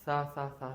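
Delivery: सा repeated softly as a th; soft sa sound. Shown as a demonstration. Teacher repeating the soft th sound. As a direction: breathe out सा three times gently. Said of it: "सा की आवाज़ आएगी".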